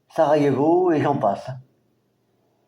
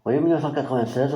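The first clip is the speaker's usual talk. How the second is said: rapide; 3 semitones lower, much faster than usual. Real, not acted. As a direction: rattle the year off quickly. Quick confirmation of the year. (confirmation rapide de l'année)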